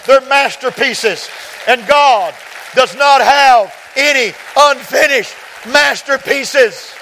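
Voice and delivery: high, loud pulpit proclamation building to a shout; rising full-force preaching; impassioned preaching. Real, not acted. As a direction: drive it to a sermon climax.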